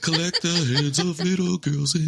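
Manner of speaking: mock creepy villain.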